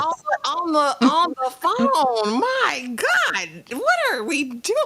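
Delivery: high, drawn-out exclamation; scandalized disbelief. Real, not acted. Co-host shocked at talk on the phone, scandalized disbelief. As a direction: stretch the words out in appalled amazement.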